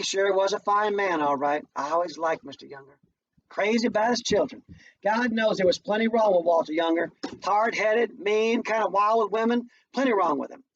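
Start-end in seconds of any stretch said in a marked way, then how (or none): none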